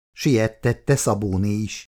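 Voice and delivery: ordinary delivery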